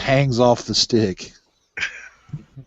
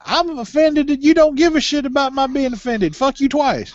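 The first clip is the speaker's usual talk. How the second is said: high mock outrage; 11 semitones higher than usual. Mock indignant squeal.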